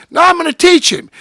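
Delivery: high loud insistent refusal; defiant shout. Retold or imitated. Refusing Buster's plea, loud defiant retort. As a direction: shout him down, determined. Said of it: "And I said".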